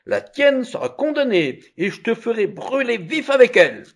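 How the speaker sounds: high, loud threat; menacing judge at full voice; angry judge. A narrator's reading. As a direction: threaten forcefully, voice climbing.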